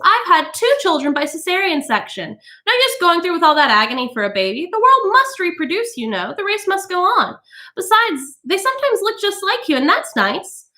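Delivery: high, chirpy boasting lady; smug society woman. A narrator's reading. Mrs Bowles boasting to the women about cesareans, chirpy. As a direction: show off breezily.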